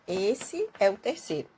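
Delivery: usual speaking voice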